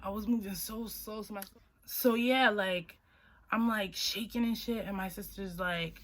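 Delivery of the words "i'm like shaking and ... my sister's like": ordinary delivery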